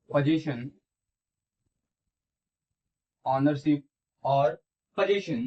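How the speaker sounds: very slow, drawn-out dictation. Shown as a demonstration. Teacher dictating the possessive pronoun definition to students, slow dictation. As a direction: give it word by word, pausing after each. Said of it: "लिखेंगे".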